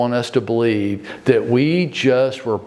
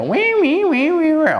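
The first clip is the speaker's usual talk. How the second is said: high squeaky mock whine, much higher; mock whining. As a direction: whine like a toddler to mock a complainer. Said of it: "you walk over to David and go".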